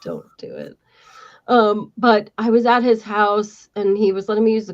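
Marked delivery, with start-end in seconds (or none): 0.0-0.8 mock deep voice. Mock deep reply to AOL voice.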